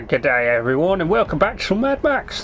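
a bad Australian accent, played for laughs; terrible Australian accent. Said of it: "That's my terrible Australian accent".

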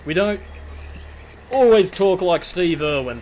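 loud, exaggerated Aussie wildlife-host voice; Steve Irwin. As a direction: punch it up like an excitable Australian TV host. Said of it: "which is pretty much what I'm sort of doing here".

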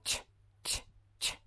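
tch with no voice, breath only; tch, tch, tch, whispered unvoiced; voiceless tch demo; voiceless affricate. Shown as a demonstration. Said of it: "não faz vibração".

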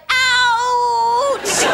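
a sudden child-acted cry of pain; mock ouch. Child acting out her mum giving birth with a yelped ouch.

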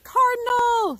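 high, loud eager shout of the answer; excited call-out. Retold or imitated. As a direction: call out the bird's name like a keen kid. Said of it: "you might be calling out".

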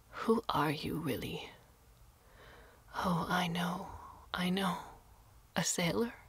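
put-on old woman musing aloud about the stranger, whispered; hushed old woman. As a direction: murmur it half to yourself. Old woman questions Carlo.

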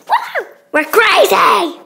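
loud, high whoop of excitement; excited whoop. Real, not acted. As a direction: cheer it out, hyped. Arriving at party, whooping.

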